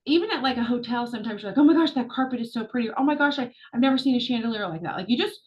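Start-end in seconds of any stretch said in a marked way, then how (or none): 1.6-2.9 higher, gushing admiration; acted-out delight; delighted wonder. Acting delighted hotel guest. 3.0-5.0 higher, quick, amazed gush; acted-out amazement; delighted wonder. Acting delighted hotel guest.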